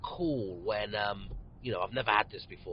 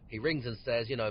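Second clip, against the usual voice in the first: usual speaking voice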